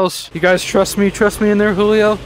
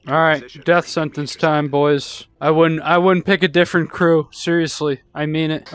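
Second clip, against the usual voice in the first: usual speaking voice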